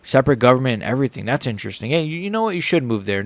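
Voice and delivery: usual speaking voice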